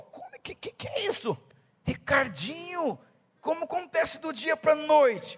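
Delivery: high, surprised mocking of the doubters; squeaky incredulous townsfolk voice; amazed questioning. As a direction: imitate people baffled at his sudden success. People amazed at Ricardinho.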